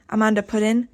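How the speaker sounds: ordinary delivery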